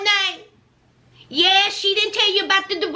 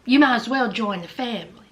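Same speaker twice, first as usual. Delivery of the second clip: ordinary delivery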